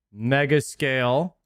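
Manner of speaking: normal talking voice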